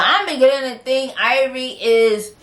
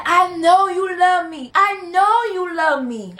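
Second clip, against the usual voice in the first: pitched up, lightly tearful plea; tearful pleading bride; 8 semitones higher than usual. Retold or imitated. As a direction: mimic a heartbroken bride begging, higher. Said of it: "She is crying with tears down her face".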